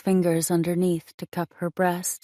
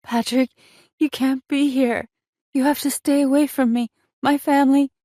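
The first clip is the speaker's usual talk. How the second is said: desperate plea; 8 semitones higher, 5 dB louder than usual. A narrator's reading. Tearful lover begs him to leave.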